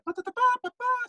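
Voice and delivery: sung high brass fanfare; fanfare imitation. Imitating the Queen's arrival fanfare, sung high. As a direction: trumpet out the royal fanfare, high. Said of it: "suddenly the fanfare".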